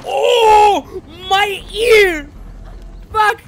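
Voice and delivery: high, loud shocked yelp; startled outcry. Real, not acted. Startled player yelling at a sudden scare. Reacting to in-game jumpscare.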